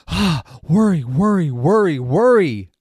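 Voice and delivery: loud, high wail of worry; panicked worry. Acted. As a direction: act out mounting worry, loudly wailing each word. Acts out being bombarded by worry.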